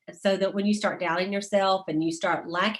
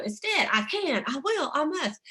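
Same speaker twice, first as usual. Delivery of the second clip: higher mock founder voice; a light impression of Mary Kay; imitating Mary Kay; 7 semitones higher than usual. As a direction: echo her upbeat rallying line. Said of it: "and she would go".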